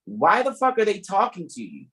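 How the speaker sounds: usual speaking voice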